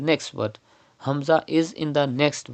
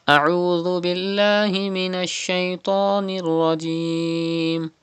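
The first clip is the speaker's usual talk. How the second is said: chanted, melodic tajweed recitation; measured Quranic chant; 4 semitones higher than usual. Shown as a demonstration.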